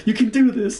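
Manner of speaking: high-pitched, hammed-up movie death speech; Louis. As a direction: play the dying comrade urging a friend on. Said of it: "this cinematic, like".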